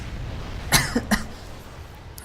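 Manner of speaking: dry cough. Real, not acted. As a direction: cough from a strained throat. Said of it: "I'm losing my voice here".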